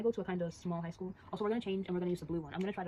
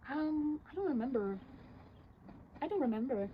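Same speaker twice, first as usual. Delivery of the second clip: higher, played-up innocent denial; feigned shock; 7 semitones higher, slower than usual. Girl confronted at the counselor's, feigning shocked innocence. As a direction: play dumb, mock shocked. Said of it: "She acts shocked".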